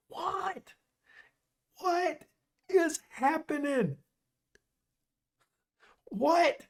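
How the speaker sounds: high, incredulous what; stunned disbelief. Real, not acted. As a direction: react to a shock result in disbelief. Reacting to upset result.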